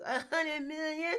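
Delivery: high, drawn-out laughing squeal of disbelief; mock disbelief. Host balks at a company revenue figure, laughing mock disbelief. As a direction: squeal it incredulously, breaking into laughter.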